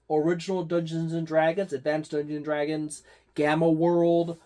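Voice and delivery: ordinary talk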